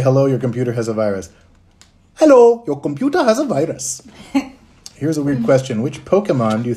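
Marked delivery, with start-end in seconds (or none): 2.2-4.0 high, loud put-on scam caller; hammed-up phone scammer, high and loud; scammer voice for Sunil. Streamer does Sunil's voice as a phone scammer. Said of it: "Sunil, can you say".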